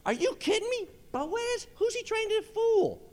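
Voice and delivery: very high incredulous squawk; mock disbelief. Workers scoffing at the boss's cheery greeting, high mock disbelief. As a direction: jump way up high in put-on disbelief.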